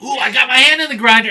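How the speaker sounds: high, loud play-acted yelp; mock alarmed exclamation. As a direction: act out a hammed-up cry of shock.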